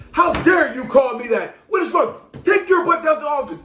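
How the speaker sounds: high, loud scolding tirade; outraged teacher. Acted. Teacher sends student to the office, outraged. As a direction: explode at the student with indignation.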